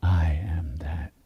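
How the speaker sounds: slow, breathy whisper of the mantra; whispered affirmation. Shown as a demonstration. Teacher whispers the affirmation to the group. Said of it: "We do it with the awareness".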